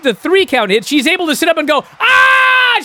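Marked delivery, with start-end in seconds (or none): 2.0-2.8 high shrill mock scream at full lung. Dana sitting up after the pin, mock scream. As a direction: let out a sudden hammed-up scream. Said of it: "She screams at the top of her lungs".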